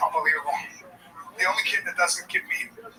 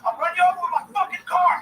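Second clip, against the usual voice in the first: mock yell; 7 semitones higher, faster than usual. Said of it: "I was like".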